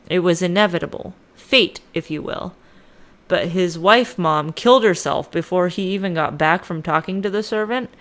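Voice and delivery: ordinary talk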